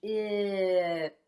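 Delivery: chip drawn out, sounds gliding together; slow blend. Shown as a demonstration. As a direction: stretch it slowly for the class. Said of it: "Blend that with me".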